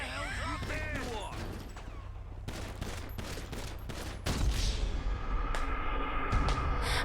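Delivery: silent breathless laugh; wheezing laugh. Real, not acted. Car wedged, helpless laughter.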